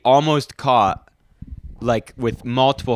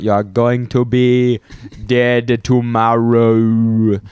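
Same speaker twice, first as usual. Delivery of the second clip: slow, louder Outer Banks drawl; Outer Banks accent; 4 dB louder, much slower than usual. Retold or imitated. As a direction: draw it out Outer Banks style. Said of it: "my Outer Banks accent".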